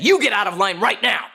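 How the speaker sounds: high, loud retold order; incensed yell. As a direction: shout it like an incensed man. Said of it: "He yells again at the back of her head".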